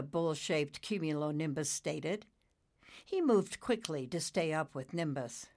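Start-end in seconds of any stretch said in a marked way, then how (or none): none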